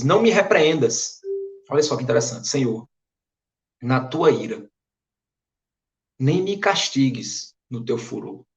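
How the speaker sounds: normal talking voice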